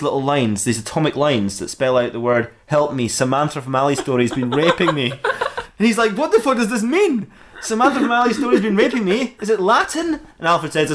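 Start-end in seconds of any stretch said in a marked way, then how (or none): 6.1-10.2 high-pitched, incredulous questioning; acted bafflement, voice pitched way up; baffled outburst. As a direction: read it back in bewildered disbelief. Batman baffled by the message.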